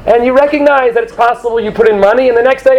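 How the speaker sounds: ordinary talk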